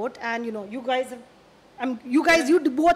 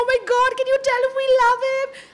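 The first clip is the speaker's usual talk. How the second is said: gushing fan; 11 semitones higher, 7 dB louder than usual. Retold or imitated. Retelling the officers gushing over Bale.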